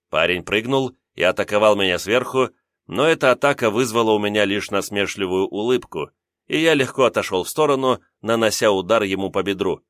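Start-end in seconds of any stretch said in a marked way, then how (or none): none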